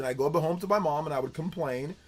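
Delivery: ordinary talk, nothing marked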